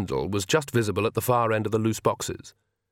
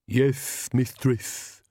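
meek; 3 semitones higher, much slower than usual. A narrator's reading. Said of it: "said Igor meekly".

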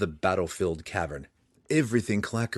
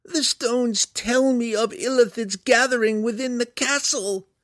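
very high, loud creature voice; monster explaining; 6 dB louder than usual. A narrator's reading. Hook Horror telling Belwar what stones say, high creature voice. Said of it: "Clacker explained".

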